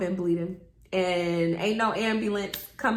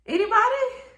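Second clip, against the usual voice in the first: Anybody? squeaked much higher; a hopeless, very high Anybody into silence; awkward repeated plea; 13 semitones higher, 4 dB louder, faster than usual. Retold or imitated. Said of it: "And I'm just like".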